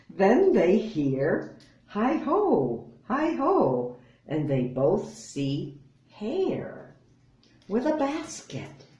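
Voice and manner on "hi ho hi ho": high, sing-song hi ho; cheerful call. Acted. Hare calling out as he arrives at the lair, high and sing-song. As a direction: call it out brightly like a happy hare.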